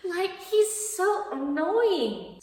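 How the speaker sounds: exasperated. Real, not acted. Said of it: "I hated this dude".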